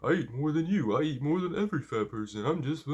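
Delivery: high, loud mock voice of a skinny braggart; skinny bragging dude. Lifter mimics the skinny guy bragging about eating, high mocking voice. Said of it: "he's gonna be like".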